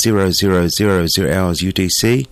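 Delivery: usual speaking voice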